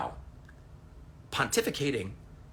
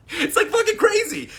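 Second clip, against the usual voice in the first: laughing, much higher and louder; 9 dB louder than usual. Real, not acted. Vlogger laughing at obsessed fans, high laughing disbelief.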